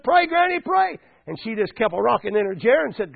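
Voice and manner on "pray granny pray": high-pitched calling across the road; urgent plea. Retold or imitated. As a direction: plead urgently with granny. Neighbour calling across the road to granny.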